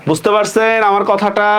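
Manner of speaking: ordinary talk, nothing marked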